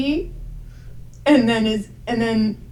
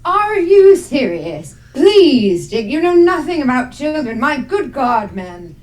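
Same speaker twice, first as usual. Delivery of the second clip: theatrical outburst that drops away at the end; indignant scolding; 4 semitones higher, 7 dB louder than usual. Acted. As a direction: scold a fool in genteel exasperation. Agnes scolds Dick over the child.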